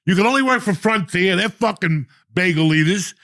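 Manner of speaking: high, loud angry rant. Retold or imitated. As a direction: tear into him, pitch way up. Ranting at the airport kid.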